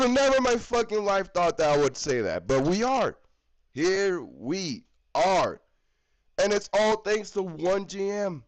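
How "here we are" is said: drawn-out, low and heavy; grim slow repeat; slow resigned. Shown as a demonstration. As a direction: let each word sink, slowly. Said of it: "slow resigned repeat".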